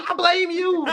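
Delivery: real laughter through the blame; high-pitched laughing accusation. Real, not acted. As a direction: crack up while accusing. Jokingly blaming a podcaster.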